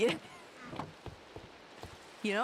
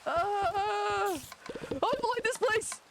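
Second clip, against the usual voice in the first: high loud yelp of fright; startled scream; 11 semitones higher, 3 dB louder, slower than usual. Real, not acted. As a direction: shriek in sudden fright. Startled by spider.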